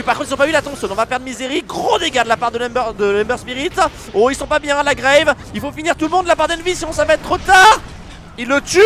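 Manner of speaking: loud, high excited play-by-play; esports caster. Real, not acted. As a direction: call the teamfight live, all hype. Live play-by-play of a fight.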